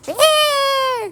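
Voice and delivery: high squealing piglet yelps, mocking; pig squeal imitation. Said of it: "That's what men sound like to me".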